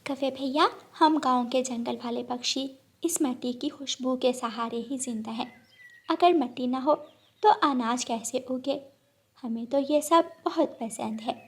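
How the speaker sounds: a village sparrow calmly defending her home; gentle sparrow. Acted. (धीमी, प्यारी चिड़िया की आवाज़)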